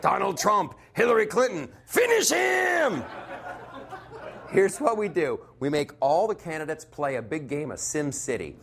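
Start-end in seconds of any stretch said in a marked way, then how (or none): 0.0-3.0 Mortal Kombat announcer. Pretend. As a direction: bellow finish him like the game. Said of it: "you know, like".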